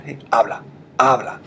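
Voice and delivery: commanding a friend. Shown as a demonstration. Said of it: "you would say directly to your friend".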